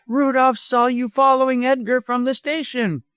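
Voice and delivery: high, insistent woman's voice; crying out an accusation. A narrator's reading. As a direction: accuse him in a high cry. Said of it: "cried Missus Moxton".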